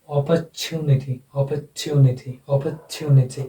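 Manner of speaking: British-style "अपॉर्चुनिटी" as op-uh-TYOO-ni-ty; British English pronunciation. Shown as a demonstration. Teacher modelling British English, "अपॉर्चुनिटी" said op-uh-TYOO-ni-ty. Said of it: "British English में".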